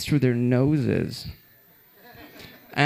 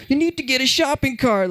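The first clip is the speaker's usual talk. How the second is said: high bossy clerk; shrill clerk voice; 11 semitones higher than usual. Retold or imitated. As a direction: order it like a fussy clerk. Clerk insists.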